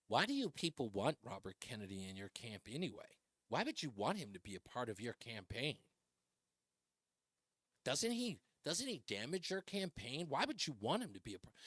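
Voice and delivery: mock worried pundit. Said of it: "say things like this".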